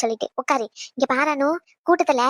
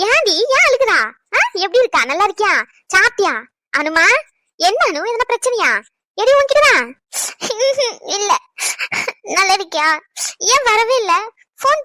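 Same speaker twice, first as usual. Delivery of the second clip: high, loud, overjoyed and worried at once; calling out to her daughter with rising alarm; loud excited greeting; 5 semitones higher, 5 dB louder than usual. Acted. Mother arriving to find her daughter crying, loud and anxious.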